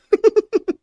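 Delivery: squealing laugh; sudden shrieking laugh; high burst of laughter. Real, not acted. Laughing at own joke.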